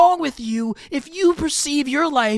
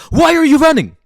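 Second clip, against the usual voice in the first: loud demand; 2 semitones higher, 7 dB louder, faster than usual. Real, not acted. Confronting people who run.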